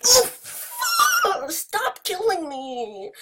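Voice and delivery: very high yelp then slow plea; high-pitched cry. Real, not acted. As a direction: squeal it out. Getting killed in game.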